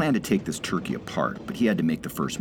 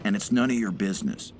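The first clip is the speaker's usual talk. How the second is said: bellowed; 4 semitones higher than usual. A narrator's reading. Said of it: "the laborer bellowed back".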